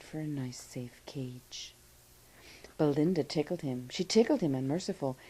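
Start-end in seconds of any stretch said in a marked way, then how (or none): 0.0-1.7 slow, soft, a touch of a whimpering plea; mild crying plea. A narrator's reading. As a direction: read it like a timid dragon begging, slowly. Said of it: "Custard cried".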